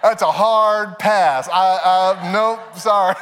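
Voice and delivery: slow, loud, laughing refusal; mock horrified dad refusing; hard pass. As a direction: drag out a firm comic no. Refusing the kids' gross drink.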